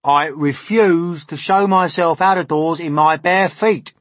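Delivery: high, loud centipede voice; put-on, high-pitched protest; indignant centipede. A narrator's reading. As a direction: refuse flatly with loud indignation.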